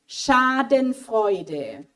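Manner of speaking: "Schadenfreude" drawn out slowly, in German, with a uvular r; slow German word. Shown as a demonstration. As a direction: spell out "Schadenfreude" slowly for the class. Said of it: "second word".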